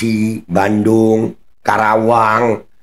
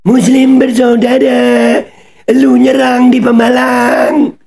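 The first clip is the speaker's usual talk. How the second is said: high-pitched, drawn-out angry yell; angry shouting; 13 semitones higher, 4 dB louder, slower than usual. Real, not acted. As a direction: yell it high and loud, stretching the phrases. Mocks played peace song, accusing attackers.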